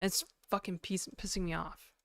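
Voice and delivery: ordinary talk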